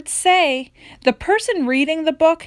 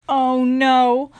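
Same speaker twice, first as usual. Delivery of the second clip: ordinary delivery, nothing marked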